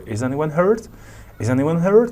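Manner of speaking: "hurt" lifted in a clear question rise; rising intonation. Shown as a demonstration. Teacher demonstrating a yes-no question, pitch rising on "hurt". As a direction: let the pitch climb at the end on "hurt". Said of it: "the intonation rises at the end".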